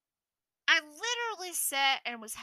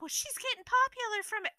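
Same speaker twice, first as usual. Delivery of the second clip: high-pitched whiny imitation of a complainer; mocking friend; 10 semitones higher than usual. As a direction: mimic her objection up high. Imitates friend's complaint after "she's like.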